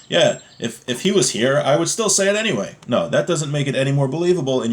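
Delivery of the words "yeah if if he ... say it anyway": mock tough-guy bravado, rising high at the end. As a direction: lightly play a swaggering tough guy. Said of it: "Everyone tries to be like a tough guy".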